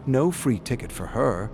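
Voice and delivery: ordinary delivery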